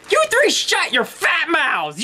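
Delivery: high, slow, yelled insult; angry toy yelling at the group; furious shout. Acted. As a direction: scream it at all three of them. Toy screams at the others.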